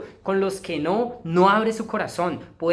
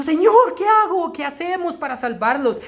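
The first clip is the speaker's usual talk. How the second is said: much higher, loud desperate cry; panicked plea; 9 semitones higher, 4 dB louder than usual. Retold or imitated. Young woman in a vision, crying to the Lord in panic. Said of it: "entró en tal pánico y dijo".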